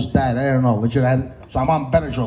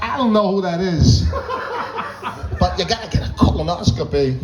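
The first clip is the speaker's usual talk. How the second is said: Christopher Walken; 6 semitones higher than usual. Acted. Doctor brushing off the question, as Christopher Walken. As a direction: draw words out with odd stresses. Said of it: "Why do you sound like Christopher Walken?".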